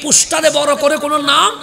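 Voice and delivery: loud, rising sermon cry over music; fervent waz preacher voice; preaching at full force. Acted. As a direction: build the crowd up, calling out each line. Climactic preaching, music behind.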